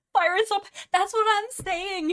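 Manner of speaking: high, loud laughing talk. Real, not acted. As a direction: squeal it through laughter. Laughing about Fireheart.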